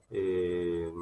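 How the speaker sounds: normal talking voice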